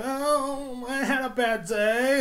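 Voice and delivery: high, drawn-out fake whine; mock crying. Mocking celebrities moaning online, high fake-crying voice. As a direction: whine it like a celebrity feeling sorry for themselves. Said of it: "And they're all crying".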